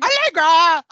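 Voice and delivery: hammed-up shout to an idol; mock fan shout. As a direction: yell it like an ecstatic fan. Joins in imitating the fan's cry.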